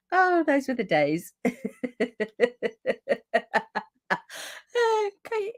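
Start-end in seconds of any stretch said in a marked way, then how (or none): none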